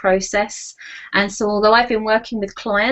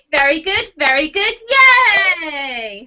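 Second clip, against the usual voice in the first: upbeat very good, very good, yay; bright self-praise cheer; 6 semitones higher, 4 dB louder than usual. Shown as a demonstration. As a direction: cheer yourself on like a coach. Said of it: "and you say".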